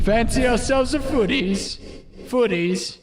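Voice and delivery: high, slow mock British accent; a hint of a posh British tease; drawn-out British caricature. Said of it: "They call it footy".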